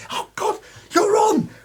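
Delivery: shrieking panic. Acted. ASM shoves him on.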